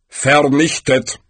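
slow, falling, weighty "Vernichtet"; Death, definite. A narrator's reading. Death repeats the doom, "Vernichtet" slow and final. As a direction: pronounce "Vernichtet" slowly as Death's final verdict.

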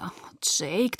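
soft groan on the name. A narrator's reading. Bella protests Jacob's unfair remark, groaning softly. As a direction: groan it quietly in mild exasperation. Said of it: "Ich stöhnte".

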